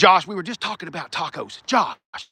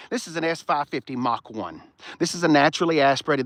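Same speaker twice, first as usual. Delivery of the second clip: usual speaking voice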